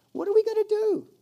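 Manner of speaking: high, loud cry of desperation; acted panic. Israelites trapped between Egyptians and Amalekites, acted panicked cry. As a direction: wail it out as if trapped.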